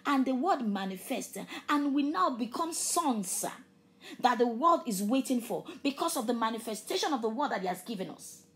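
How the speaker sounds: loud, fervent preaching that stretches key words. Acted. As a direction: preach it with full force to the congregation. Preaching at full force.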